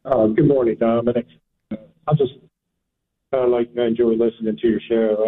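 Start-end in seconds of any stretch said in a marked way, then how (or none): 1.7-5.2 caller on a speakerphone; distant, hollow phone sound. Real, not acted. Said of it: "It sounds like you're on a speakerphone".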